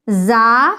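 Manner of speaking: زا with a light whistle; whistling ز. Shown as a demonstration. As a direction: let زا hiss like a whistle. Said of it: "سیٹی کی طرح تھوڑی آواز نکلنی چاہیے". (زا سیٹی جیسی آواز کے ساتھ)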